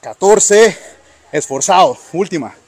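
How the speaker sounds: loud, straining count; strained effort. Real, not acted. Coach pushes lifter through rep.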